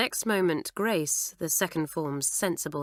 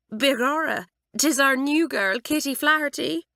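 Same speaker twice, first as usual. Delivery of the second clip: playful mock Irish accent, a bit higher; 8 semitones higher, 4 dB louder than usual. Doris teasing her friends, in a put-on Irish accent. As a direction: put on a jolly Irish brogue for fun. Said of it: "in a fine imitation of an Irish accent".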